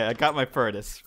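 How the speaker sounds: normal talking voice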